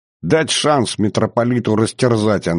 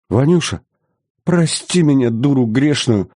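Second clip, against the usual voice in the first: higher pleading lament, a touch tearful; wailing plea; 6 semitones higher than usual. A narrator's reading. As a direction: beg forgiveness in a lamenting voice. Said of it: "Всхлипнув, запричитала Мария Ярославна".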